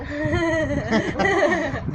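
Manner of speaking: soft, drawn-out chuckle; real amused chuckle. Real, not acted. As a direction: chuckle quietly to yourself. Laughs after asking who sent her.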